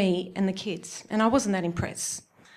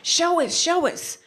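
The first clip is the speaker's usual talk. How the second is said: high, excited chanting; eager friends; 5 semitones higher than usual. Retold or imitated. Friends and family begging to see the boob job, mock eager. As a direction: pester her, begging to see. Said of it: "friends and family going".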